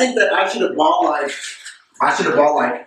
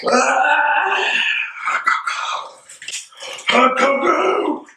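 high, slow and drawn out; excited; 8 semitones higher, much slower than usual. Real, not acted. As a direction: savor the words happily. Excited over hot cocoa.

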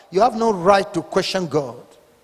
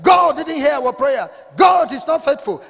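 high, shouted imitation of whining believers; mock complaint; 6 semitones higher than usual. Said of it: "some of you who are crying and saying".

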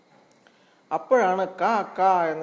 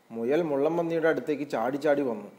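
usual speaking voice

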